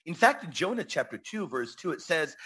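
usual speaking voice

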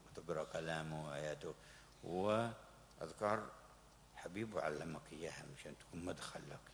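ordinary talk, nothing marked

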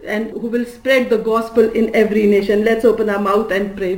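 ordinary delivery

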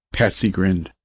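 ordinary delivery

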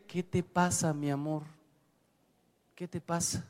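soft, soothing reply to a spouse; gentle, hushed endearment; tender, affectionate. Acted. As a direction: drop the volume and ask lovingly. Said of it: "perfumada con tu cariño".

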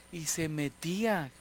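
ordinary talk, nothing marked

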